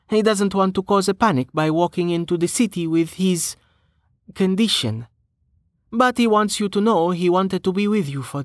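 lifted, lilting character voice; Amelia, light female character voice. Acted.

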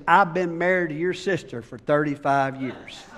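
low, quiet and dry; deadpan punchline. Retold or imitated. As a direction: drop it flat for the punchline. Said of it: "he says".

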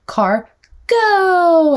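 "go" stretched out long and high; animated, slow, emphasized. Shown as a demonstration. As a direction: draw out "go" with big playful emphasis. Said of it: "it's really animated, and I go really slow".

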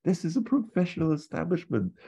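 high indignant outraged Basil; imitating Basil Fawlty. Hotel owner refusing to scribble a sign, high indignant voice. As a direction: sputter like an affronted hotelier. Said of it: "Basil's like".

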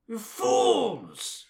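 loud, high, theatrical condemnation; hammed-up cry of despair at idiots; mock outraged scolding. Scolding the characters as fools, mock outrage.

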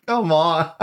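usual speaking voice

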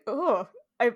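ordinary delivery

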